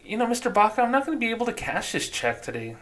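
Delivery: high-pitched, polite teller voice; bank teller. Acted. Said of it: "softer voice and a little bit more high-pitched".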